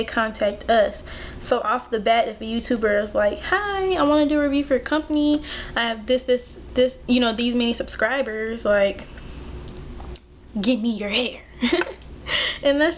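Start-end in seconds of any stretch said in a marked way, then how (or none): none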